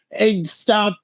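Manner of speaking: high, drawn-out mock whine; mocking high-pitched slow complaint; whiny baby. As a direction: imitate a whiny baby protesting. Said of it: "whiny babies just being like".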